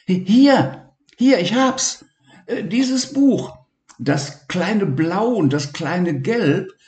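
high, eager, tripping over words; high-pitched excited boy; excited discovery. Acted. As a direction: blurt out the find. Levi excited at finding book.

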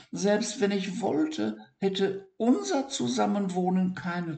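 high, sorry hedgehog voice; regretful hedgehog. Acted. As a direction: let him down gently.

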